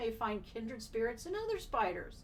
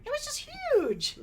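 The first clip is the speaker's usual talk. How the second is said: high-pitched, loud quoted woman; high woman's voice; 7 dB louder than usual. Shown as a demonstration. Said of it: "Very nice".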